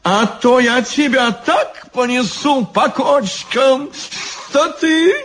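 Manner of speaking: very high mock-fierce threat; snapped threat. A narrator's reading. Elegant devil threatening Ivan, high-pitched snapping. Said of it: "рявкнул изящный чёрт".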